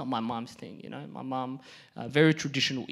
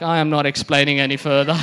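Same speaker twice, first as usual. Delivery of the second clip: loud, emphatic shutdown; firm refusal; 12 dB louder than usual. Retold or imitated. Son giving up explaining to mom, loud exasperated refusal. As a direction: end the discussion loudly.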